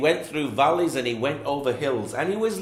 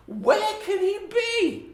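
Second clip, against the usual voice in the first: very high, slow worried call; searching shepherd; slower than usual. Acted. Shepherd searching hills, very high slow worried call. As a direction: call out, puzzled and anxious.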